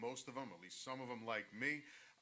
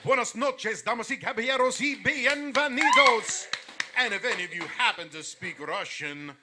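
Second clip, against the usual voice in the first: lucha libre announcer; 3 semitones higher, 11 dB louder than usual. Shown as a demonstration. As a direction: announce it like a big title fight, loud and high. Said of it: "We want the intro".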